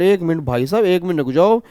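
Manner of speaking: ordinary talk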